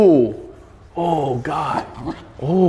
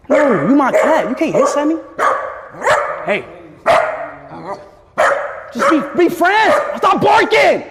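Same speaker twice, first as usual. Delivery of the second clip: high, pleading, then a shout; rising exasperated scold; 10 semitones higher than usual. Real, not acted. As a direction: scold the pets, building to a yell. Scolding hissing cat, barking dog.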